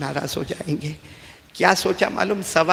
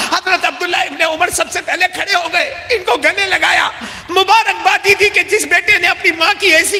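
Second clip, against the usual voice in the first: high, loud shouting preacher; impassioned preaching; 13 semitones higher, 7 dB louder than usual. Acted. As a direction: cry out the climax to the crowd. Preaching at full force, shouted. (بلند اور جوشیلی آواز میں خطابت)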